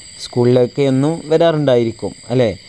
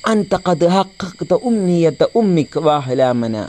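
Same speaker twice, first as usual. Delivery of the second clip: slow, raised lilting Arabic recitation; slow, raised recital; Arabic reading; 5 semitones higher, slower than usual. Shown as a demonstration. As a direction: read the Arabic line slowly and fondly as the proud teacher. Reading teacher's proud Arabic line.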